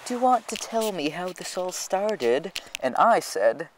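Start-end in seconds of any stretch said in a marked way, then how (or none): 0.0-2.5 higher mock teacher voice. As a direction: imitate the smug teacher. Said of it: "she was like".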